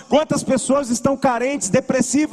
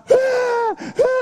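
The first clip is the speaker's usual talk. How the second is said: put-on high wailing baby cry; imitated baby crying; 13 semitones higher than usual. Uncle imitating the niece waking at night, high crying wail. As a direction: mimic a baby's sudden high wail. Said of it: "e chorando, chorando, chorando".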